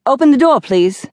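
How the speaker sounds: police shout easing on the last word; shouted order. A narrator's reading. Policeman demands entry to the suite, shouting. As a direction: call out a loud, high command through the door. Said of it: "a male voice shouted".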